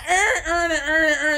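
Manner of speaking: high, laughing "urn urn", mock Baltimore; imitated Baltimore accent. Streamer mimicking a clip, laughing Baltimore accent. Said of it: "they would be like".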